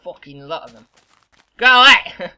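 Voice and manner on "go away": high, very loud yell; shrieking at a crowd of mobs; scream. Real, not acted. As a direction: scream it at the attackers.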